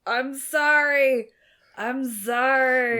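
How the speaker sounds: loud, high, long-drawn call; shouted apology. Acted. Imitating a bandmate apologising across the theatre, drawn-out shout. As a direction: holler it slowly across a huge hall. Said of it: "They're all shouting from various locations".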